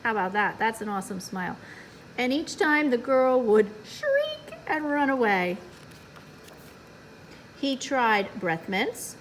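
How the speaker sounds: ordinary delivery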